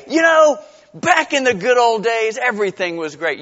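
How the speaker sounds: a loud, mocking sigh for the past; mock nostalgia. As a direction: ham up the longing for the old days. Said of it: "I mean, we say".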